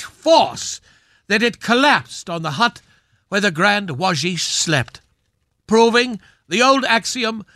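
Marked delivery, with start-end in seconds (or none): none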